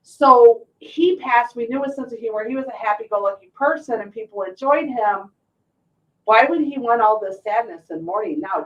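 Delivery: usual speaking voice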